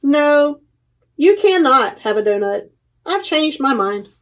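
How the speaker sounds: lively picture book read-aloud with paused page turns; reading to children. Acted. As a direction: read it out to a room of little kids, giving each animal a light voice.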